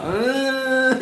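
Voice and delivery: high, held sceptical "uhhh"; doubtful drawn-out "uhh". Shown as a demonstration. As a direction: slide up into a long doubting hum. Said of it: "I give the same face all the time".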